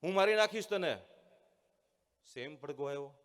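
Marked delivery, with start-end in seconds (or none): 0.0-1.0 loud angry yell, higher; angry shout. A narrator's reading. Angry boy yelling at his own echo, loud threat. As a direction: shout a threat at the unseen mocker. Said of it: "મોટેથી રાડ પાડી".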